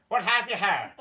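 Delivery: put-on high, loud Belfast accent through laughter; hammed-up Northern Irish question. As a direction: mimic the Belfast man asking. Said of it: "He went".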